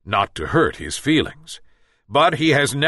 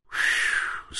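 whistle; 7 dB quieter than usual. Acted. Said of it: "said the djinn, whistling".

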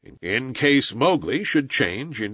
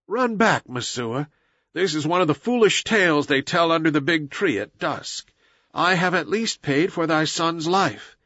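put-on higher-pitched, reassuring young voice; earnest boy hero; 5 semitones higher than usual. Mowgli says farewell to his village mother, earnest.

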